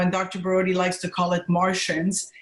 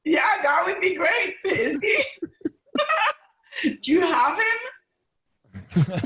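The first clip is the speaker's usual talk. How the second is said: high, excited near-shout; excited delight; 10 semitones higher, slower than usual. Real, not acted. Guest thrilled the doctor might join, excited delight. Thrilled at idea of colleague joining.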